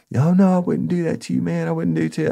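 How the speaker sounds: pleading informant. Retold or imitated. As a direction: beg like a cornered snitch.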